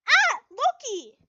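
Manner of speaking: high, loud cry of a name; calling out. Acted. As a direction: shout across the beach. Calling out on the beach.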